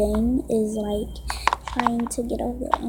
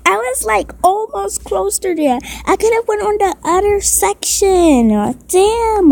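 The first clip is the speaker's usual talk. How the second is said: higher, louder, exasperated complaint; frustrated laughing rant; 7 semitones higher, 4 dB louder than usual. Real, not acted. Kid complaining to viewers about the miss, frustrated and high.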